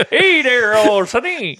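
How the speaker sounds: folksy Southern greeting through a laugh; Southern accent. Pretend. Bigfoot from the South, mock Southern accent. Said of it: "Or from the South".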